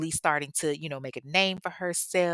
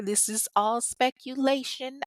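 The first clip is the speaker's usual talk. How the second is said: speculation drawn out, higher and slow; stress on speculation; 6 semitones higher than usual. Shown as a demonstration. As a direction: lean hard on speculation so nobody sues. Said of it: "I stress the word speculation".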